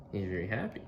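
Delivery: plain reading voice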